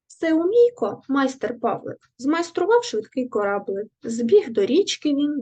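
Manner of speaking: lively storybook poem recital; poem reading. Acted. Teacher reading a poem to the class, lively recital. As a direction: read the rhyme brightly to young pupils.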